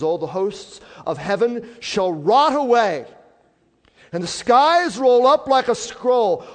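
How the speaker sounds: very loud, high preacher's declamation; thundering scripture reading. Real, not acted. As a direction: proclaim the prophecy at full force. Declaims scripture at full force.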